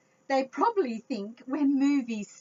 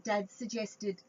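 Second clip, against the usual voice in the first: usual speaking voice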